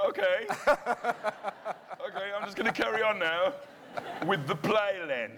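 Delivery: high, laughing imitation of a co-star; mock her dry put-down through laughter; imitating co-star Lucy correcting him mid-scene, laughing; co-star's deadpan reply. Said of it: "looked at me and went".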